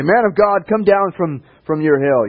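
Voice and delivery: calling out. Retold or imitated. Guard of fifty calls up to the prophet, loud. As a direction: shout it up to the man on the mountain.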